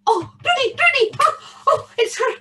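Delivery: high, loud excited cries; thrilled recognition. A narrator's reading. Tam hears his cow moo, thrilled. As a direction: burst out with breathless joy.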